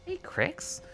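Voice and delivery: squeaky high hello to the cat; cooing greeting to a pet. Real, not acted. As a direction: call the cat in a high sing-song.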